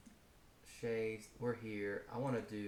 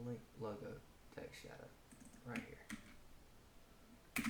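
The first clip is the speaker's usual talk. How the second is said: muttered to himself; 9 dB quieter, slower than usual. Real, not acted. Muttering code while typing it.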